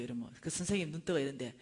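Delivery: normal talking voice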